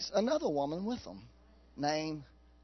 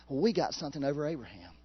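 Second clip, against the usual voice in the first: ordinary talk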